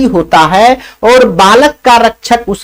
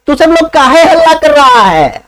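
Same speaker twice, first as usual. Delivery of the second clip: annoyed lazy man; 6 semitones higher than usual. Acted. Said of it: "रहा है चौथा".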